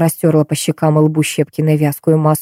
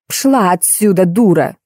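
narrated angry squeal, little raised; mild mock screech; slower than usual. A narrator's reading. Actress chases out the make-up artist, mildly screeching. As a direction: hurl the insult without real volume. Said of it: "завизжала Софья".

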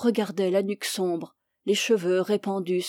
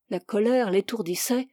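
usual speaking voice

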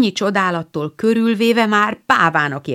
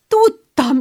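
higher and louder joyful cry; excited; 11 semitones higher, 5 dB louder than usual. A narrator's reading. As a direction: burst out with delight. Beth repeats in rising joy.

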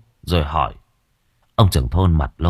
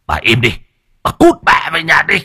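louder, higher angry snap; harsh rebuke; 8 semitones higher, 5 dB louder than usual. Acted. Husband shoving his grieving wife away, angry harsh order. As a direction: shut her down hard and send her off.

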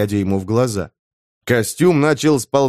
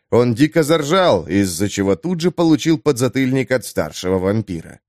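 ordinary delivery, nothing marked

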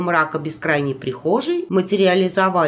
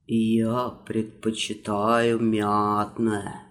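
low character voice; 9 semitones lower, 5 dB quieter, much slower than usual. Acted. Character answers, low slow.

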